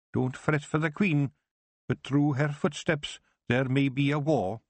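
put-on a wee Scots elder cautioning the girl; Scottish Feegle Kelder. The Kelder speaks, Scots dialect.

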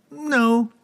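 high amused wizard. Acted. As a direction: say it with a wide smile. Wizard answering.

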